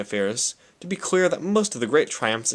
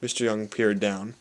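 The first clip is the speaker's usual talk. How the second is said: plain reading voice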